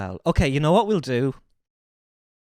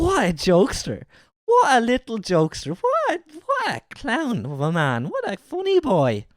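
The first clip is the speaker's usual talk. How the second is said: mock fond praise; 6 semitones higher than usual.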